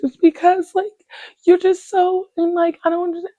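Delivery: high, whiny crying voice; mock crying roommate. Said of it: "And then she starts crying".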